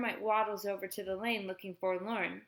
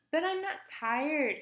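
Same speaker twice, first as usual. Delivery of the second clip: put-on high, drawn-out child's whine; whining; 7 semitones higher, slower than usual. A narrator's reading. Boy refusing his nap to his mother, whining. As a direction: stretch out a whiny protest. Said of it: "Kermit whines".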